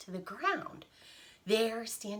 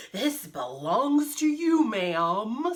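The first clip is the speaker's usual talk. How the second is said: elephant character; 7 dB louder, slower than usual. Acted. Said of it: "said the elephant".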